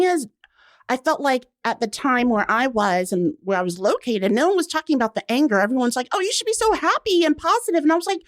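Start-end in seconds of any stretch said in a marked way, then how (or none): 6.1-7.8 mock cheery. Mocking people's cheery advice.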